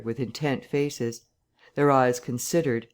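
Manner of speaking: plain reading voice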